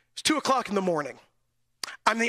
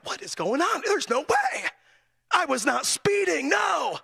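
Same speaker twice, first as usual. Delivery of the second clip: indignant outrage; 6 semitones higher than usual. Retold or imitated. Preacher retelling being pulled over again, high indignant protest. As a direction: protest that you did nothing wrong, voice climbing high.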